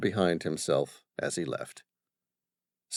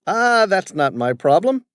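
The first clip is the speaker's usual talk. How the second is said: high, loud, dismissive brush-off; arrogant; 11 semitones higher, 8 dB louder than usual. Acted. Father dismissing his son's warning, arrogant. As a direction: wave it away like it's beneath you. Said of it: "said arrogantly".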